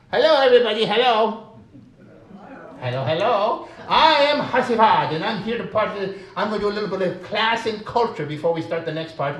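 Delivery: high, loud, chirpy puppet sidekick greeting the crowd; high cheerful puppet voice; Hasivad puppet voice. Acted. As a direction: play the boastful buddy puppet, bright and eager.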